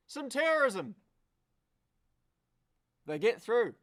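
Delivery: high, loud burst of race excitement; excited shout. Real, not acted. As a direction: cheer the move through.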